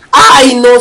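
ordinary talk

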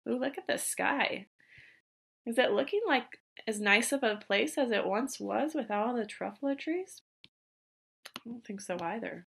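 quiet aside. Real, not acted.

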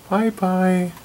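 voiced, high sing-song "Bye-bye." to the dog; cheerful bye-bye. Real, not acted.

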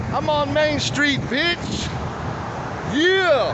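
high, slow, hyped street yell; excited yell. Real, not acted. Man hyping himself on Main Street, excited yell. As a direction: holler it to the whole block.

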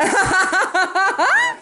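high, slow laughing words. Real, not acted. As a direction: laugh through it, stretched out. Laughing while opening gift.

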